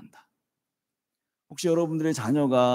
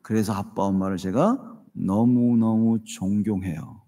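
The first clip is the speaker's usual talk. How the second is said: slow, lower, heartfelt confession; son's sincere words, slow and tender; heartfelt respect; 7 semitones lower, slower than usual. Retold or imitated. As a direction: let 존경해요 sink in slowly. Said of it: "마지막에 뭔 얘기하는 줄 아세요?".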